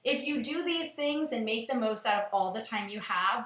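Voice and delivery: ordinary delivery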